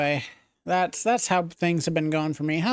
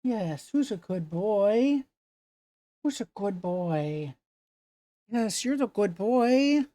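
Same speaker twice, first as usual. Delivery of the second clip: talking to a dog; 3 semitones higher than usual. Real, not acted. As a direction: praise the dog sweetly. Baby talk to dog.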